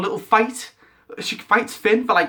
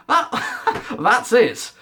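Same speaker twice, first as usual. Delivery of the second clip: high cracked laugh through the words; can't believe it, laugh it out; laughing in disbelief; 6 semitones higher, much slower than usual. Real, not acted. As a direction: fan laughs at the letdown. Laughs at the reveal.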